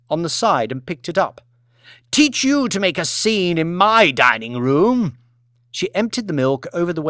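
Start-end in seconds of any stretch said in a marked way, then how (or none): 2.1-5.2 haughty scolding. Acted. Lady Elizabeth punishes waitress.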